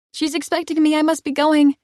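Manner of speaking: high, loud hurried shout. Acted. Heroine shouting at the doorman as the doors close. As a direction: shout it over your shoulder. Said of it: "I shout".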